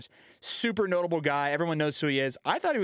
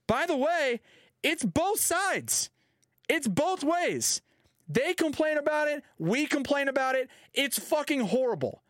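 high, slow, exasperated complaining; imitating a ballplayer fed up with the umpire; sarcastic high-pitched rant; mock angry player rant; 9 semitones higher, slower than usual. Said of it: "is what he said".

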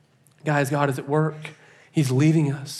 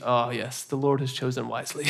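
softer, low, knowing murmur; sly aside; 4 semitones lower, 8 dB quieter than usual. Retold or imitated. James leans over to the pastor, softly and slyly pleased. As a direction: lean in and tell the secret with a grin. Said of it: "James leans over to me and goes".

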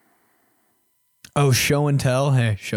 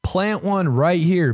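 1 eager insisting; 6 semitones higher than usual. Retold or imitated. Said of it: "And he goes".

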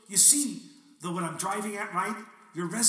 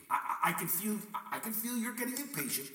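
ordinary talk, nothing marked

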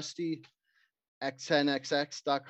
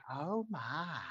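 soft, high little "Oh my" of a flustered lady; mock flustered belle; 3 semitones higher, 10 dB quieter than usual. Housewife spotting the shirtless monk, soft and high mock-flustered. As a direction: let her be quietly flustered at the sight. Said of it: "she's like".

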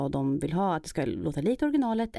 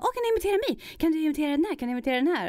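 eager fan; 10 semitones higher, much faster than usual. Retold or imitated.

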